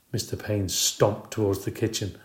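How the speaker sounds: usual speaking voice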